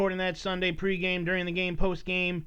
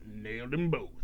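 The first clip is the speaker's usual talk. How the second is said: low, soft cheesy action-hero quip; deadpan movie one-liner; 8 semitones lower, 7 dB quieter than usual. Retold or imitated. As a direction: drop the line low and dry like a cop hero. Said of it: "and then he's just like".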